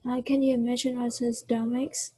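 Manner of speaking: ordinary delivery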